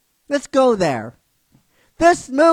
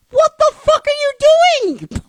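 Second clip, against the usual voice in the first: much higher, louder, slow outraged cry; exasperated yell; 14 semitones higher, 5 dB louder than usual. Real, not acted. Ranting at the studio to viewers, high outraged yell. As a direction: let the outrage burst out, stretched.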